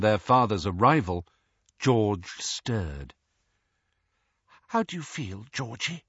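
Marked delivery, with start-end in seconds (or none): 4.7-6.0 softer, gentle whisper; mother whispering to her son. Acted. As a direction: ask him tenderly under your breath. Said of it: "whispered Missus Weasley".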